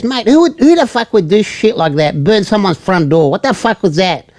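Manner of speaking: high-pitched indignant questioning; mock angry rant. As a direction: imitate an irate boyfriend ranting at a bystander. Acted angry boyfriend rants at driver.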